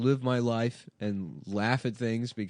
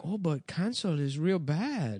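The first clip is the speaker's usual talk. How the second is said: high, slow mock mom voice; imitating mom mishearing; immigrant mom voice; 7 semitones higher than usual. Comedian imitating his mom mishearing cancel culture. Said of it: "And they're like".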